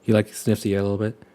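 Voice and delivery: ordinary delivery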